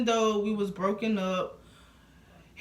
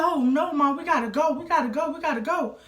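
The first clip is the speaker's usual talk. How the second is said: mock urgent alarm; 7 semitones higher, 3 dB louder than usual. Ex-boyfriend urgently rouses his mom.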